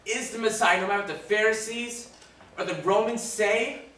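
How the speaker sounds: high, loud declaration of faith; fervent proclamation. Acted. Dying robber declaring Jesus the Messiah, fervent and loud. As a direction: proclaim it with rising conviction, full voice.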